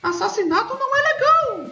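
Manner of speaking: put-on high, squeaky children's TV host; sing-song voice, like a cheerful kids' presenter; kids show host voice. Mock children's TV host greeting.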